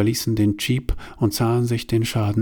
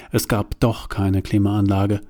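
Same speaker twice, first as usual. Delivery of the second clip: ordinary delivery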